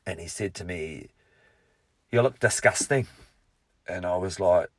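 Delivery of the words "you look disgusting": put-on Scottish accent. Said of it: "Notice I've ejected the Scottish accent".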